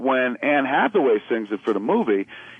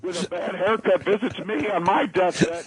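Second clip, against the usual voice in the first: high, hammed-up wail of mortality; overwrought, high-pitched mock despair; mock dread; 8 semitones higher than usual. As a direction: plead in comic panic as if dying. Acts out viewer overcome by film.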